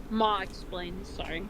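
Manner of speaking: ordinary delivery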